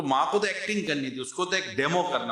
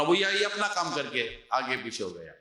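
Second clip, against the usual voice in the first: usual speaking voice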